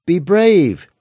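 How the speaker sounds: high, loud call across the water. A narrator's reading. Jesus calling across the lake to scared disciples, loud call. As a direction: call out to reassure them over the waves. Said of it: "Jesus called out to them".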